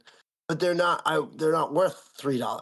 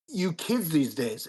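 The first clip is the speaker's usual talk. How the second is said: condescending; 2 semitones higher, slower than usual. Retold or imitated. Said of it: "she was like".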